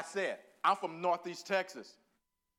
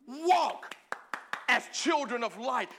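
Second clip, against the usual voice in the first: higher and louder, a preacher's call; preached out loud; 6 semitones higher, 6 dB louder, slower than usual. Acted. Preacher calling the verse out to the congregation, loud and high. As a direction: proclaim it to the whole church.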